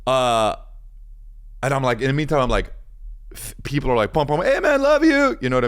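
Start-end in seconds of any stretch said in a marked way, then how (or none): 4.1-5.4 mock calling out. Retold people calling out.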